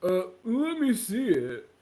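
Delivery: put-on slow, loud, dopey boy voice; mock a clerk slowly catching on; clueless store clerk. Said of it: "He was like".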